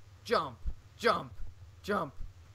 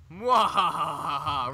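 slow hammy villain laugh; slow loud evil mwahaha; evil cackle; 7 dB louder, much slower than usual. Acted. Villain laugh after blowing up.

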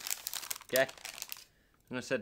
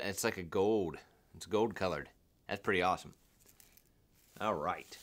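ordinary talk, nothing marked

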